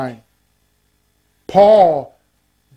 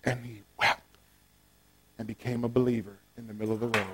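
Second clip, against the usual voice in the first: much softer and lower; preacher hushes the crowd for the climax; hushed telling; 14 semitones lower, 16 dB quieter, faster than usual. Real, not acted. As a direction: let it fall to a hush. Preacher drops very soft.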